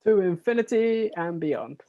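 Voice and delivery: high, loud rallying cry; heroic catchphrase. Acted. Guest answers his introduction with a film catchphrase, loud and heroic. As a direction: proclaim it like a grand film catchphrase.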